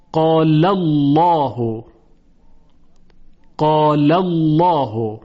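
قال الله slow, with a thick dark l in Allah; heavy lam. Shown as a demonstration. Teacher giving the fatha example, slow heavy قال الله. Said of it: "لام الله تفخیم بشه".

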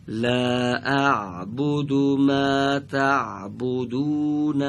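لا اعبد ما تعبدون said slowly; slow model. Shown as a demonstration. Teacher showing where to start, slow recitation. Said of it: "از اول خط پنجم".

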